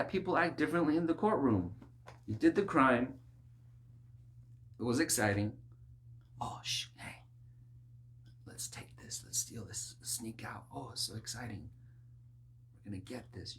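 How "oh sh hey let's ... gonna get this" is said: whispered, much softer, sneaky thieves; conspiratorial whisper. Pretend. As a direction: hush it like thieves stealing.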